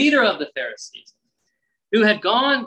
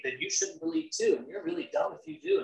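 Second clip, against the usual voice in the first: high, soft put-on sneer; a snooty voice looking down on believers; mocking snob voice; 10 semitones higher, 8 dB quieter than usual. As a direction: parody the know-it-all crowd. Mocking peer pressure voice.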